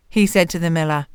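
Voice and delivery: ordinary delivery, nothing marked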